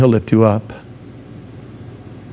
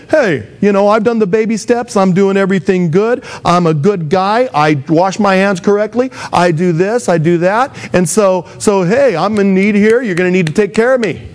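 higher-pitched, self-satisfied listing of merits; entitled demand; 7 semitones higher than usual. Acted. A believer demanding God's kingdom for good behavior, mock entitled. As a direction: press your claim as if it is owed. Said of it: "demand his entire kingdom".

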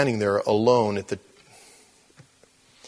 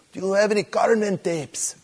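higher, lightly voiced thick Mexican accent; 9 semitones higher than usual. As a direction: speak it in a heavy Mexican accent. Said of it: "His accent was so thick".

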